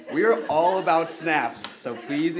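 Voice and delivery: loud, high, drawn-out cheer for the audience; hyping the crowd. Real, not acted. Host telling the audience to snap along, hyped up. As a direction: call it out big and slow to a whole room.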